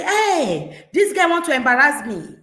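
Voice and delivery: indignant parent. Retold or imitated. Parent embarrassed before guests, loud and indignant. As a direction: huff about being embarrassed in public. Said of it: "We will say".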